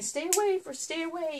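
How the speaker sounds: high imitation of a frightened husband; lightly hammed-up squeal to keep back; mock scared plea. Husband begging his wife to keep the snake away, mock fright.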